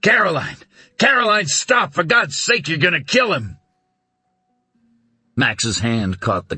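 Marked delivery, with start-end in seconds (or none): 0.0-3.6 urgent shout. Acted. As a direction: yell to break through her frenzy. Max stopping her beating.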